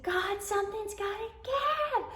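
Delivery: high, slow desperate cry to God; a pleading shout up to the heavens, stretched slow; screamed out to God. Retold or imitated. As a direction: cry it out at the top of your range, drawn out.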